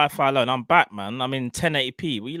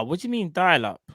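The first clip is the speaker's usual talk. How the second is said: ordinary talk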